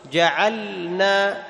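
"جعلنا" drawn out very slowly with the lam clearly sounded. Shown as a demonstration. Teacher modelling the correct reading for students, slow and clear. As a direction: stretch "جعلنا" slowly and keep the lam distinct before the nun. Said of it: "تعطي اللام صفات التوسط".

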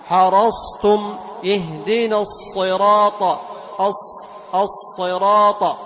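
drawn-out, emphatic ص in الصراط; emphatic sad. Shown as a demonstration. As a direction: keep full ص in حرصتم and الصراط. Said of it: "لا بد أن يحافظ القارئ على إطباق الصاد".

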